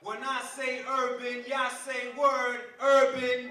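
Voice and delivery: rallying crowd chant lead-in; call-and-response. Acted. Poet warming up the crowd, rallying call-and-response.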